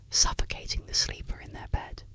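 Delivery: plain reading voice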